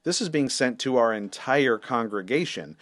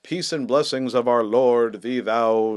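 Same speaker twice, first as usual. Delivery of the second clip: slow, solemn churchy intoning; mock liturgical; slower than usual. Pastor mocking the scammer's churchy sign-off, slow mock-solemn. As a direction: parody a pious old-fashioned blessing. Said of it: "weird semi-liturgical language going on there".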